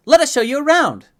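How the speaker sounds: very high, loud eager twins; creepy unison. A narrator's reading. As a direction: chirp it out loudly as creepy twins. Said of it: "Again, in a creepy unison".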